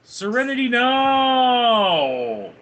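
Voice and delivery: high, slow, loud yell; shouting. Acted. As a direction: scream it in frustration. Seinfeld quote shouted.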